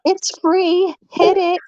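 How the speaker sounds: very high, put-on plea for likes; mocking streamer voice. Woman imitating other channels' viewers for babe, high mocking voice. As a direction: mimic a streamer in a high, silly voice. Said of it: "they say it in that voice, too".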